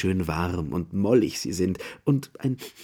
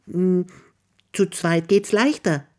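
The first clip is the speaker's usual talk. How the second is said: Mrs Bear's voice; 9 semitones higher than usual. Acted. Bear answers the hare.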